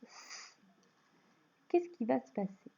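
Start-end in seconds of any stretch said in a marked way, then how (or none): 0.0-0.6 S as a long, soft voiceless hiss; hissed S sound. Shown as a demonstration. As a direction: hold the pure S sound, sss. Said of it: "je veux la faire chanter".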